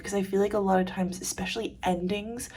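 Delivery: usual speaking voice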